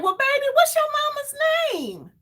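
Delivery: very high, put-on elderly woman's voice; old lady voice. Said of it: "You know how old people talk".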